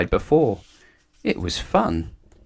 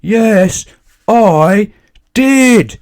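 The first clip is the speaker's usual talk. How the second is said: loud, high, drawn-out shout; top of his voice; 13 semitones higher, 9 dB louder, much slower than usual. A narrator's reading. Mister Noisy bellows at the butcher. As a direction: bellow each word. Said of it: "shouted Mister Noisy at the very top of his voice".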